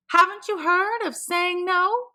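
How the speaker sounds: high, prim neighbour voice; a busybody's high voice; nosy neighbour voice. A narrator's reading.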